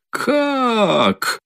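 a sudden high cry of shock. A narrator's reading. Katerina, stunned by the accusation, cries out high. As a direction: yelp it out high, startled. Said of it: "вскрикнула вдруг".

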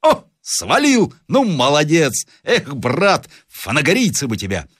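азарт сержанта. A narrator's reading. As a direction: cheer the marksmen on excitedly. Sergeant cheers on the shooters. (азартные возгласы старого вояки)

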